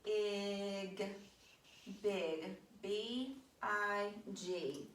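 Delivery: "B I G" spelled slowly, letter by letter; teacher spelling slowly while writing on the board. Shown as a demonstration. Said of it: "let's write that word".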